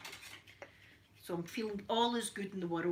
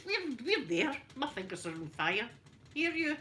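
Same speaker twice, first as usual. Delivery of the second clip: normal talking voice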